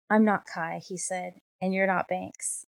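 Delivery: ordinary delivery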